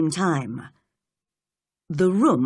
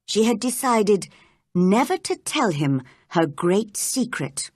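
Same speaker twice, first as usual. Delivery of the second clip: ordinary delivery, nothing marked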